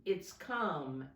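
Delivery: ordinary delivery, nothing marked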